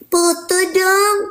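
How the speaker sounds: mock whiny high "Foto dong", drawn out slowly; mengejek gaya cegil. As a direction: mimic a clingy fan begging for a photo. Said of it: "cegil yang cuman hanya sekedar foto doang". (menirukan minta foto dengan manja)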